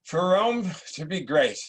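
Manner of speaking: slow, weighty command; a hint of a stern dying father; insistent dying emperor. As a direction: press the wise must rule on him. Emperor insists on his will.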